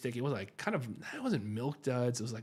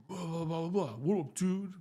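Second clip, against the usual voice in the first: mock barking dude; 6 semitones higher than usual. Retelling the driver who barked back, higher mocking voice. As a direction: sneer it back like the guy barking at you. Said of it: "barks back at me".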